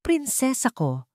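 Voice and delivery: slow, high and tender; a fond father calling his daughter. A narrator's reading. Father gently calling his little princess, tender. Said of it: "sa malambing na tinig".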